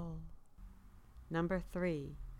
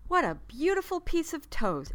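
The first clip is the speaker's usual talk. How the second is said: higher, louder little toaster voice; proud exclamation; 10 semitones higher, 8 dB louder than usual. A narrator's reading. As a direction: praise it like a proud teacher. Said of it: "exclaimed Toaster".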